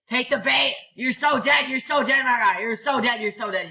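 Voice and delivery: high, loud repeated jeer, easing towards the end; excited taunting. Real, not acted. Gamer baiting an opponent, excited taunting. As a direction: crow at the opponent.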